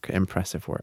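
softly repeating under the breath; quiet echo. Shown as a demonstration. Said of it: "That is impressive work".

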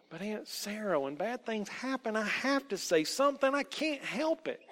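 put-on high-pitched young girl, protesting; troubled child. A narrator's reading. Troubled Rosie excuses herself to Aunt Sarah, pleading.

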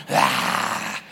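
deep put-on grunt of disapproval; low mock grunt. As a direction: end the nag with a low grunt. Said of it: "I don't know who I'm imitating".

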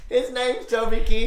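high and loud, laughing through the words. Real, not acted. Teacher laughs out her colleague's nickname for the kid, high and loud. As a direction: giggle out the nickname.

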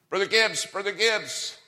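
high, loud call across a crowd. Retold or imitated. Preacher calling across the line, loud and high. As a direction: shout the name to get his attention.

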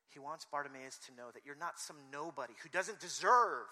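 ordinary delivery

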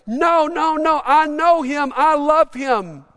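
high, loud, drawn-out protest; impassioned public defence. Acted. Someone stands up in the crowd for Jesus, loud and pleading. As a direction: cry out to stand up for him. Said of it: "stand up in this crowd and say".

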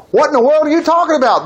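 a touch of outraged protest; mild demanding. Retold or imitated. Brothers protesting to the steward, mild indignant demand. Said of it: "they demanded".

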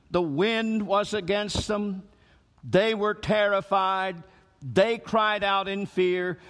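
measured, slow, phrase by phrase; slow emphasis. Shown as a demonstration. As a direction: lay out each phrase deliberately. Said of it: "look at some phrases in that text".

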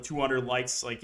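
normal talking voice

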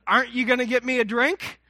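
mock outraged toddler asking for her drink; demanding child. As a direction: daughter scolding daddy for no drink, high and loud. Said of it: "She goes".

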